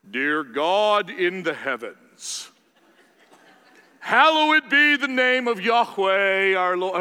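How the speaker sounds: slow, grand, booming movie-epic prayer; Charlton Heston prayer voice. Retold or imitated. Pastor imitating Bud's booming Charlton Heston prayer voice. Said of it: "Charlton Heston just crawled inside of Bud".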